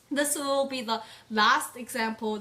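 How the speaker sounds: usual speaking voice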